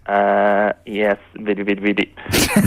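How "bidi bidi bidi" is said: Tweaky robot noise. Retold or imitated. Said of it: "the noise that Tweaky makes".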